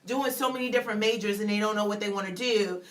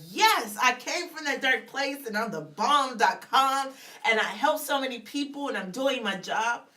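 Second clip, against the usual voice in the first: mock bragging, a little louder; chest-beating boast; 3 semitones higher, 4 dB louder than usual. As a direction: puff up like King Kong. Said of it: "beat on our chest like we're King Kong".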